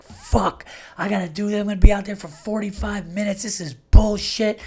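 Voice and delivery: high-pitched exasperated griping; retold pissed-off rant. Man griping about his dog getting out, pissed-off retold rant. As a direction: act out being pissed off, dragging out the last words. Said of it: "I was pissed when he got out".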